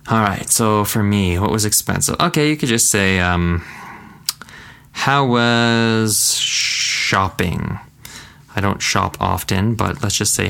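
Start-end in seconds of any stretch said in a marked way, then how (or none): 4.9-7.8 How was shopping stretched very slowly; slow drawn question. Acted. Teacher acting a friend asking about shopping, slow. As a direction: drag the question out. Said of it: "acted question".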